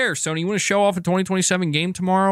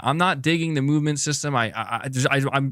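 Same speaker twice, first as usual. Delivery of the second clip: ordinary talk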